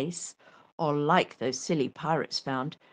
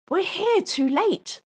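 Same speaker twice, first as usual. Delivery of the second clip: high, loud dismayed gasp; gasping pirates; 12 semitones higher, 6 dB louder than usual. Acted. Pirates gasping at the rival crew, high and loud. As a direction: gasp at the sight.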